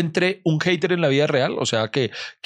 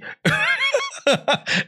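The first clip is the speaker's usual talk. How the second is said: high shrieking laugh. Real, not acted. Bursts out laughing. (carcajada muy aguda)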